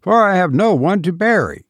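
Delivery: mildly sharp correction; sharply. A narrator's reading. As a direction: snap back, a touch indignant. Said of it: "said Eustace sharply".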